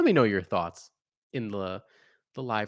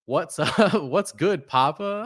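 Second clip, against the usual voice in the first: excited greeting; 6 semitones higher, 7 dB louder, slower than usual. Retold or imitated. Reading a chat greeting aloud.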